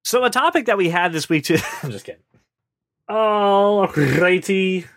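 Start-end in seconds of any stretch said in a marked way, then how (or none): 3.1-4.8 slow, drawn-out Irish "Alrighty"; Irish accent. Acted. Host kicking off the topic, jokey Irish accent. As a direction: stretch it out in a jokey Irish lilt. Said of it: "Are you gonna do this entire episode in an Irish accent?".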